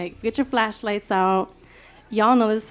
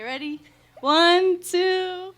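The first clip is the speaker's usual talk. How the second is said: usual speaking voice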